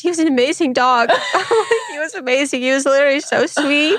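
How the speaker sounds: hammed-up high-pitched weepy voice; imitated crying. Client crying to therapist about her dog, mock tearful. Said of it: "now that I'm crying about her dog".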